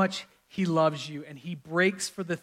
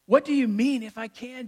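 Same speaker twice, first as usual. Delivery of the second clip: incredulous Jesus; 6 semitones higher, 3 dB louder than usual. Acted. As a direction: throw the doubt back, starting loud and high. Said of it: "Jesus says to him".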